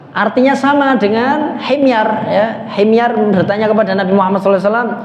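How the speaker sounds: normal talking voice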